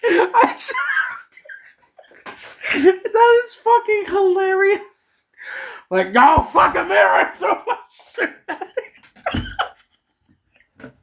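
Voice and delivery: high, loud, helpless laughter through words; hysterics, easing off at the end; real laughing fit. Real, not acted. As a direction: squeal out the words while cracking up. Reader cracks up at the story.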